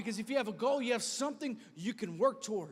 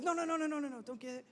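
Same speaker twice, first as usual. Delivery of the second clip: pleading bed; 6 semitones higher, faster than usual. Acted. Bed begs sleeper to stay.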